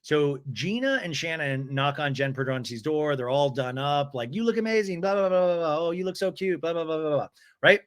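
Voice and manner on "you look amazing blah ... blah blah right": high, sing-song fake compliments; mock gushing. Recapper mocks friends complimenting each other, fake gushing. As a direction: gush on autopilot, sliding into blahs.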